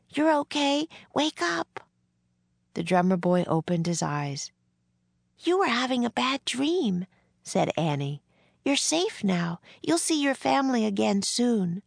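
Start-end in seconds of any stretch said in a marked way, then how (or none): 0.1-1.8 put-on young girl rousing someone. A narrator's reading. Annie reassures the waking boy, high and urging. 5.4-7.1 put-on high, girlish reassurance; comforting young girl. A narrator's reading. Annie to John after his bad dream, high and reassuring. 8.6-11.8 put-on high girl's comforting voice; reassuring young girl. A narrator's reading. Annie promises John his family, high and reassuring.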